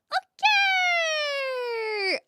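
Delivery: big high shout of Okay; excited shout. Real, not acted. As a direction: burst out with a bright greeting. Hyped greeting to chat.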